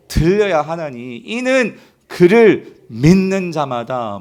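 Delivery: slow, loud scripture reading; leading unison reading. Real, not acted. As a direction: lead the congregation phrase by phrase, firmly. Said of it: "같이 읽어볼게요". (크고 느린 성경 봉독)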